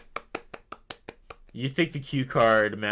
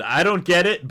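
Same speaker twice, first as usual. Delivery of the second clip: raised, emphatic quote; louder retelling; 7 semitones higher, 7 dB louder than usual. Retold or imitated. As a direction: punch it out louder. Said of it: "I said".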